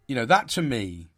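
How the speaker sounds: ordinary delivery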